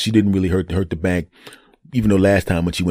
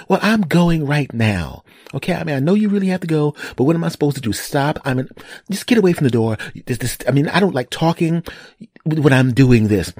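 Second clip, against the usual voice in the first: higher mock wife voice; imitated annoyed wife; 7 semitones higher than usual. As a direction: fend off the pleading as the wife. Voices annoyed wife behind bathroom door.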